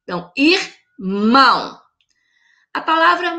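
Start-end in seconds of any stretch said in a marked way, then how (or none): 0.4-1.8 "irmão" in two slow, separate beats; split into syllables. Shown as a demonstration. Teacher repeating the example to pupils, "irmão" split with stress on "mão". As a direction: pause after the first syllable of "irmão", stretch the second. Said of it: "Vamos separar em sílaba".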